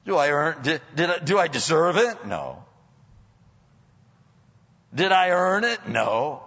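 rising preacher's challenge, loud questions; preaching. Acted. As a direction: fire the questions at the congregation. Rhetorical self-questioning.

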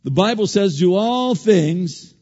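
loud, slow preaching cry. Acted. Preacher crying out scripture to the congregation, loud. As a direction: drive the scripture home.

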